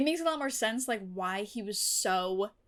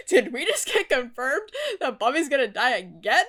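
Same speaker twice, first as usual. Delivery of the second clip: loud, exasperated disbelief; 9 dB louder than usual. Real, not acted. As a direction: demand it loudly in disbelief. Shocked reaction to show.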